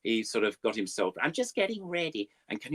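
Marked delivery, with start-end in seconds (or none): 1.2-2.3 very high beaver puppet voice; squeaky beaver in a bad American accent. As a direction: pitch it way up like a cartoon beaver. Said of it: "I did this terrible American accent".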